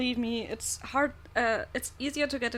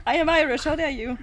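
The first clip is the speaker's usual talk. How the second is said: loud mock outrage, higher; feigned offense, how dare you; mock indignant; 4 semitones higher, 7 dB louder than usual. Mock offense at being called German.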